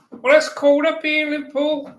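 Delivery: high, loud mock Scouse; Liverpool accent. Shown as a demonstration. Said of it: "We're trying our Liverpool accent".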